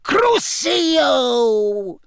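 high, loud villain's curse; piercing, drawn-out cold incantation. A narrator's reading. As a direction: hurl the spell in a long, high shout. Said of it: "said the cold voice".

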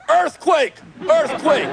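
high-pitched fake alarm cry; demonstrating a scream of warning; mock panicked scream. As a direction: sound a pretend earthquake alarm. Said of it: "you wake her up and scream".

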